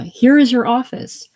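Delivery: loud, high-pitched challenge; scornful outburst; jeering retort. Acted. Leona jeers at Doc's licence claim.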